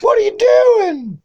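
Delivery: high squeaky yell, slowed and stretched out; Mastiff yell. Retold or imitated. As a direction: yell it in a Mickey Mouse voice. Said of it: "nobody yelled like Mastiff".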